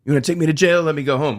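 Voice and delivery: loud, high, desperate pleading cop; shouting Denzel. Retold or imitated. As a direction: bark it out, begging and bullying at once. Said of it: "he's like".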